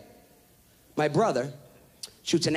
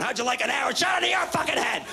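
angry dad; 6 semitones higher than usual. Retold or imitated. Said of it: "my dad's going".

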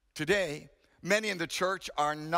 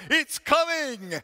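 slow, high, loud yell; louder yell; 7 semitones higher, 5 dB louder, slower than usual. Retold or imitated. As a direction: yell it out desperately. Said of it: "So he yelled louder".

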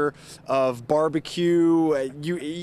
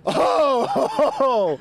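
pained yelp; 8 semitones higher, 3 dB louder than usual. Real, not acted. Reacts to the hot pepper.

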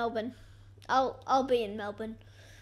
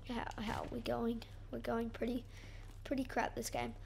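whisper; 9 dB quieter than usual. Real, not acted. Hushed mid-game commentary.

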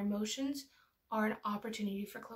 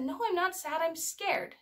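higher-pitched imitation of a little girl; child's protest; 6 semitones higher, slower than usual. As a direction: mimic a kid correcting mom. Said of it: "And she said".

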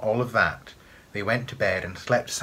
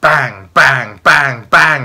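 loud, forceful repeated blows; booming sound-effect words; pounding bangs; 5 semitones higher, 14 dB louder, much slower than usual. A narrator's reading. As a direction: hammer out each word loudly. Narrator acts the banging on door.

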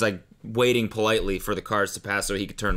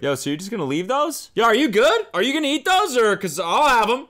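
higher, louder cheeky dude; bystander dude; 10 semitones higher, 4 dB louder than usual. Retold or imitated. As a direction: call out asking for the wheels. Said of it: "This dude ... is like".

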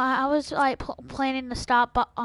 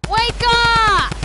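loud high call; shouting; 9 semitones higher, 8 dB louder than usual. Acted. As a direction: yell to wake someone. Calling to wake the kid.

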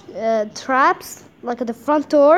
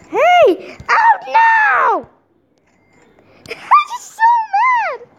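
shrill mock-furious yelling, much higher and louder; overly angry comment; 4 dB louder, much slower than usual. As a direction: ham up an outraged player shouting at you. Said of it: "She's gonna do an overly angry comment".

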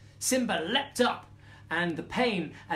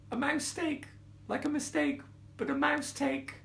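high little mouse voice; explaining mouse; 7 semitones higher, 3 dB quieter than usual. A narrator's reading. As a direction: explain timidly in a small high voice. Mouse nervously explains its pun.

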